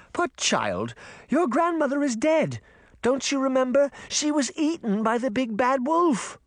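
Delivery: put-on high, sugary villainess talking down to a child; Queen of Hearts voice. A narrator's reading. Wicked queen to the little girl, high and sweet. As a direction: coo false pity at the girl. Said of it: "Queen speaks to the girl".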